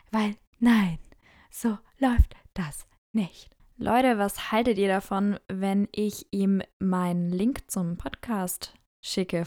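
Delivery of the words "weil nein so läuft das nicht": a hushed but firm refusal; whispering, shaking her head at the idea; whispered no. Real, not acted. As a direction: whisper it firmly, slowly. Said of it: "unvoiced whisper".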